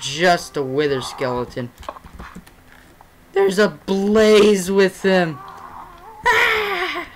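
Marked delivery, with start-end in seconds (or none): 3.3-5.4 slow frightened gasp of dread; spooked, dragging the words out; scared horror game streamer. Real, not acted. Blaze spotted, frightened. 6.3-7.1 short high yelp of fright; jump-scare shriek; startled scream. Real, not acted.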